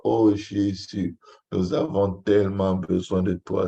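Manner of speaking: ordinary delivery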